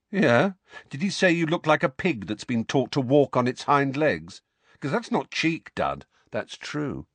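high, sneering jab at a cousin; mocking taunt. A narrator's reading. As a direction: tease him with a mocking lilt. Harry mocking his cousin.

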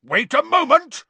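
loud, high outraged bellow. A narrator's reading. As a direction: bellow it at the fireplace. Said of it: "he bellowed at the fire".

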